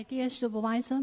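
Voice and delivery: ordinary talk, nothing marked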